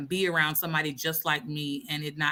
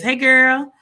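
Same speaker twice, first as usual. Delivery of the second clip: excited greeting; 8 semitones higher, 11 dB louder than usual. Real, not acted. Greeting sister in chat.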